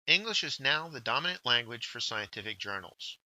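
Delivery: ordinary talk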